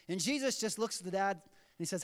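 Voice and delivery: ordinary talk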